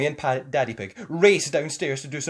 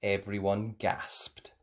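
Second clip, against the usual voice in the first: ordinary delivery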